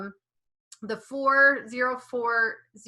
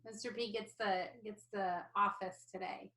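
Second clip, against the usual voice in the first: distant, off-mic voice; speaking from the next room; from another room; 14 dB quieter than usual. Real, not acted. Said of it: "I'm doing work from the other room".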